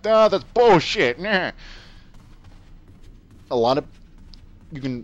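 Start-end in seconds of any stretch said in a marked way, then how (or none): 0.1-1.5 louder, slow scoff of disbelief. Retold or imitated. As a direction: wave it off as nonsense. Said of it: "most of you can be like".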